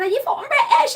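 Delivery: high-pitched mock British accent; exaggerated British astonishment. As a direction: squeal it in a put-on British accent. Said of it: "talking the British accent".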